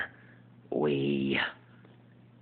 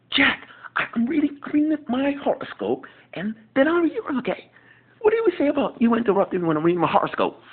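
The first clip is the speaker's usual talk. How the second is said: higher, louder, fast exasperated voice; Will impression; 5 dB louder, faster than usual. Will scolding Jack for interrupting his horoscope. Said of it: "my impersonation of Will".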